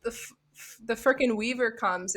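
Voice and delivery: ordinary delivery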